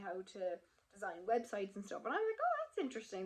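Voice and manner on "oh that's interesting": high-pitched intrigued reaction; retold interest. Student reacting to news about website classes, intrigued. Retells her pleased reaction.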